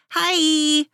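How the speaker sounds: high, sing-out greeting; chirp. A narrator's reading. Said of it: "she chirped".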